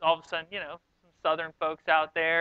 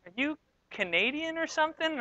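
higher, softer puzzled question; mock a baffled listener asking quietly, pitched up; puzzled onlooker; 7 semitones higher, 8 dB quieter than usual. Canadians eyeing him in the meeting, imitated as puzzled. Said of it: "they start looking at me like".

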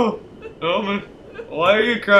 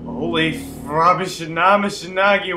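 ordinary talk, nothing marked

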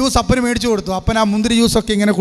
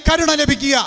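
raised-pitch preacher's proclamation; preaching; 6 semitones higher than usual. Acted. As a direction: preach it to the congregation. Preacher's opening declaration. (പ്രസംഗ ശൈലി)

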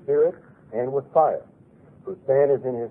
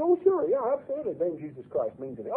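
quick, offhand "absolutely", rattled off; mock glib answer; 9 semitones higher, 4 dB quieter, faster than usual. Preacher mimicking a listener's careless answer, fast and glib. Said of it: "at first you say".

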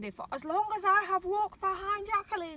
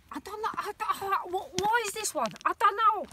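faint Cockney accent creeping in; a hint of Cockney, higher and quicker; slipped into Cockney; 3 semitones higher, faster than usual. Real, not acted. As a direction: let a light Cockney accent slip out. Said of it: "I've gone Cockney".

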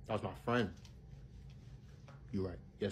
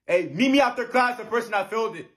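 mock teacher shout; 12 semitones higher, 10 dB louder than usual. Teacher mocking student who failed.

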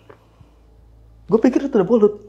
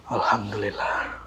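slow whispered thanks; relieved whisper; 5 semitones lower, 10 dB quieter, slower than usual. Retold or imitated. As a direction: breathe out alhamdulillah in relief. Lega setelah duduk di pos. (bisikan lega yang pelan)

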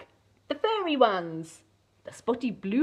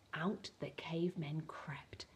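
whisper; 5 semitones lower, 15 dB quieter than usual. A narrator's reading. Cavemen creeping out.

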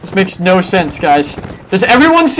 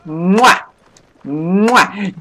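double air kiss. Pretend. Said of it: "Let me kiss you on the cheeks".

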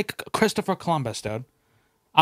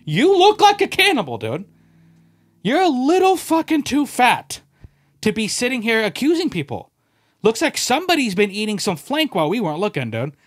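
higher, louder accusation; mock accusing shout; 6 semitones higher, 4 dB louder than usual. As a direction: yell it at a friend mid-game. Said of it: "It's like".

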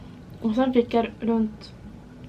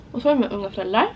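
normal talking voice

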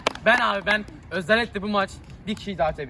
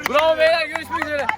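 high, raised send-off; called-out cheer; 6 semitones higher than usual. Real, not acted. Player congratulating the team as the video signs off, calling out high. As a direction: call it out to the whole team.

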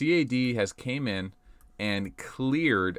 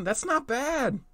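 much higher, pleasantly surprised; squeaky surprised; slower than usual. Real, not acted. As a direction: squeak it out in delight. Repeats verdict, first squeaky high.

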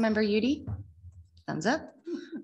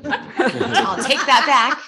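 laughing; 6 semitones higher, 8 dB louder, much slower than usual. Real, not acted. Retracting with a laugh.

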